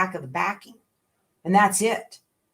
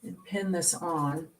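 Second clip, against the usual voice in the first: talking with pins in mouth; 6 dB quieter than usual. Real, not acted. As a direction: speak around pins held in lips. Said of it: "I have pins in my mouth".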